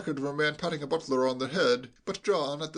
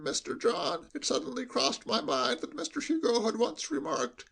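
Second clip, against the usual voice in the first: put-on high, hushed bleating old butler; lowered sheep bleat; 8 semitones higher than usual. A narrator's reading. Butler confiding to John at the door, hushed high bleat. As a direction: confide it like a bleating sheep with cotton wool in its mouth. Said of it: "it was in a lowered voice, which gave his delivery the effect of a sheep bleating".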